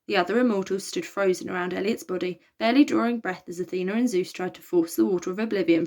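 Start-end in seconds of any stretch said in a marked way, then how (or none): none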